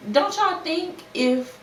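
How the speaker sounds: ordinary talk, nothing marked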